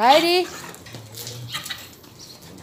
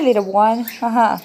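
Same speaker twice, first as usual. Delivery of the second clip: ordinary talk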